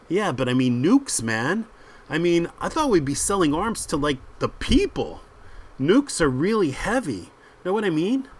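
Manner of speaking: hesitant protest to a tougher mate; worried sidekick. Acted. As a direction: plead your doubts with rising, swooping pitch. Voices worried Trevor.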